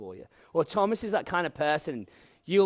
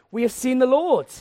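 higher and louder, joyful; excited announcement; 8 semitones higher, 9 dB louder than usual. Acted. Disciples telling Thomas, excited and loud. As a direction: burst out with the good news. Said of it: "the other disciples told him".

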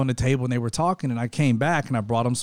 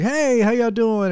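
cheery server greeting; 9 semitones higher, 3 dB louder, slower than usual. Retold or imitated. Server greeting old classmates.

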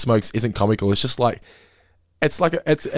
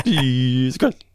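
TV announcer; 4 semitones higher, much slower than usual. Retold or imitated. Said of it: "that's what they do".

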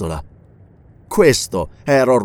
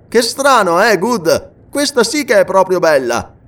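high, louder burst of amused surprise; amused exclamation; 7 semitones higher, 3 dB louder than usual. Acted. Sir Henry marvels to Good at Allan's plan, amused. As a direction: exclaim it, still chuckling at the idea. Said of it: "scoppiò a ridere. E poi esclamò".

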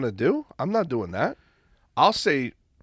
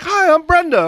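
mimicking Brenda; 7 dB louder than usual. Mimicking a caller's voice.

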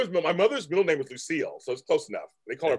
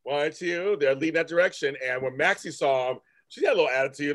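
ordinary delivery

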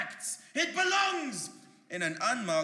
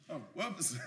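normal talking voice

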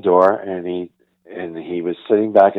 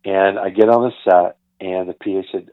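ordinary talk, nothing marked

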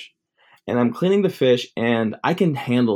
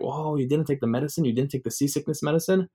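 higher-pitched questioning imitation; astonished family; 4 semitones higher than usual. Family hearing about skipped medicine, astonished.

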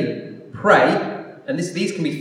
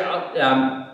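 slow, low, trailing-off dodge; hesitant, embarrassed shrug of a reply; sheepish mumble; 4 semitones lower, 3 dB quieter, much slower than usual. Retold or imitated. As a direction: mumble an awkward non-answer. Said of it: "I kinda just go".

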